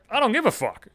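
contemptuous fuck-off; imagined angry retort. Retold or imitated. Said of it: "I wanted to say right there".

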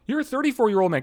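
high exasperated self-lecture, pitch way up; mock exasperated talking-to; self-scolding. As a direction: scold yourself in disbelief. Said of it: "I'm thinking to myself".

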